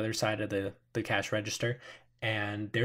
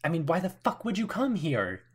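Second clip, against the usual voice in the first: higher, exasperated question; mild exasperation; 7 semitones higher than usual. Acted. Woman presses the group, exasperated. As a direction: challenge them. Armed woman demands answer.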